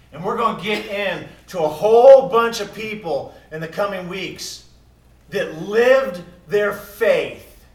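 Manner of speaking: loud, high sermon delivery; full-force preaching. Real, not acted. Pastor preaching loudly to the church about faith. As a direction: preach it with fire.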